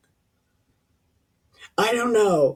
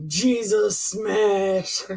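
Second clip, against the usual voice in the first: mock Hulk catchphrase; 4 semitones higher, much slower than usual.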